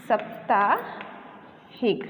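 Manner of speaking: साप्ताहिक drawn out very slowly; slow wrong pronunciation. Shown as a demonstration. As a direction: stretch साप्ताहिक as the wrong form. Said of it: "अशुद्ध वर्तनी में". (साप्ताहिक बहुत धीरे)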